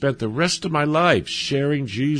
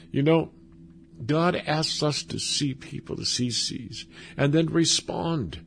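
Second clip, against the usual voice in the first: ordinary delivery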